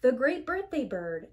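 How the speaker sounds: plain reading voice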